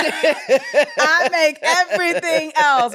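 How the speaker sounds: slow, laughing it out. Real, not acted. Host admits to her listeners she cannot make rice, laughing. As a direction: giggle through the words.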